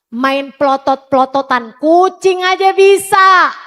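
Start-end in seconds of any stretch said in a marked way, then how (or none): 1.8-3.5 slower, louder, driving the punchline home; punchline stress. Real, not acted. Preacher telling the mothers that even a cat can do this, loud and slow. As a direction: lean on each word, mocking the anger.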